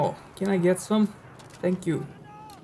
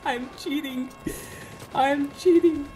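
laughing; 9 semitones higher than usual. Real, not acted. Laughing at own lucky kills.

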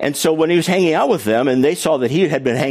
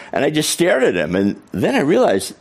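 ordinary talk

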